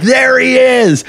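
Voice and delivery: high, slow mock holler; retold pointing-out cry. Runner pointing out gunman to cops, calling out. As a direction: stretch it into a high call.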